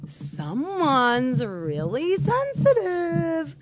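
slow, high, drawn-out sneer; mock the spouse's feelings, dripping sarcasm; sarcastic mocking.

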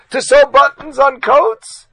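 loud, high, slow mocking question; loud high scornful To sew buttons; scornful shout. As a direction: hurl the question at him slowly. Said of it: "Reb Chanan lost it".

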